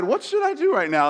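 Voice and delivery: high-pitched, imagined inner voice; playful high-voiced wondering; mock indecision. Voicing man in photo, panicked.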